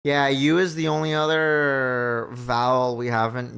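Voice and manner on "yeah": usual speaking voice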